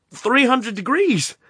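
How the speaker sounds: slow, high, loud disbelieving echo; alarmed question. A narrator's reading. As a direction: repeat it in shocked disbelief. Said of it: "said Rort".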